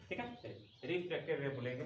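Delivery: ordinary talk, nothing marked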